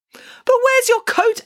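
high, loud, drawn-out query; alarmed question. A narrator's reading. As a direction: ask it in exasperated astonishment. Hannah alarmed girl lacks coat.

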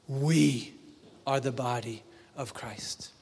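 ordinary talk

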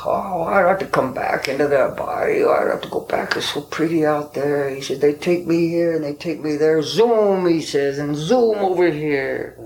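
put-on high, airy voice of the old elder; voicing the old friend. Narrator voicing his Paiute elder friend, dreamy and high.

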